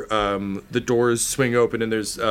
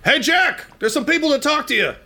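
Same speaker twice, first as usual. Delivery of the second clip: calling out. Acted. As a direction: call your coworker over. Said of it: "He's like".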